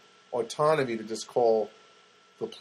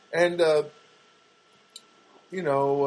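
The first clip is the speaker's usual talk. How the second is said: ordinary talk, nothing marked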